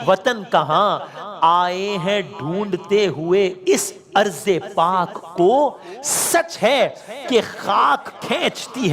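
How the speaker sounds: sing-song lament, drawing out each line; elegy recitation. Acted.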